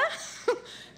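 short breathy laugh, high and soft. Real, not acted. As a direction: laugh lightly at yourself. Laughs at own point. (risa suave y breve)

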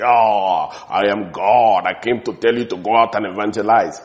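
loud, booming heavenly apparition; mock voice of God. Preacher parodying a dream visitation, mock-booming divine voice. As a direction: boom it out as an exaggerated God in a dream. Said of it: "You will not hear God talk like that".

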